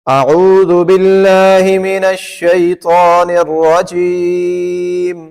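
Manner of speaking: اعوذ باللہ من الشیطان الرجیم slow and high, no "w" sound in الشیطان or الرجیم; correct recitation. Shown as a demonstration. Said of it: "واو کی آواز تو کہیں بھی نہیں ہے".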